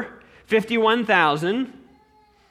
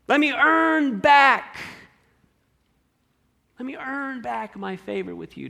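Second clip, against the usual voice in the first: grovel to God, half-hearted mock sobbing; blubbering plea; 5 semitones higher than usual. Believer bargaining with God, mock blubbering plea. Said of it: "we sound like this very same blubbering servant".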